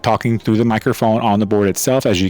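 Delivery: loud mic through the board; talking into the board mic; board mic test. Shown as a demonstration. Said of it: "a quick mic test showing".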